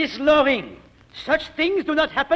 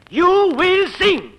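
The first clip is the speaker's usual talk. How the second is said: slow, louder, each word hammered down; the teacher lays down the law; stern command; 4 semitones higher, 4 dB louder, much slower than usual. Acted. Voice teacher ordering his pupil to sing, slow and stern.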